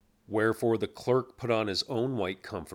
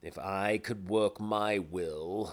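ordinary delivery

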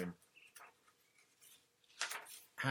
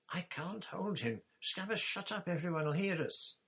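panicked; 9 semitones higher than usual. Acted. Ron panicking with struggling rat.

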